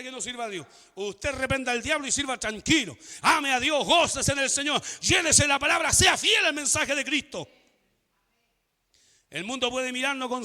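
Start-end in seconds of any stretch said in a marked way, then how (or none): 3.2-7.5 loud, driving pulpit commands; fiery exhortation. Real, not acted. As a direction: urge the congregation on loudly. Preaches at full force.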